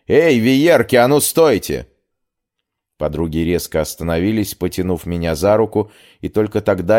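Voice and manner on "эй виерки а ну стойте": loud, rude street call; rough shout. Acted. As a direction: bark it out to stop them. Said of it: "заслышав грубое обращение".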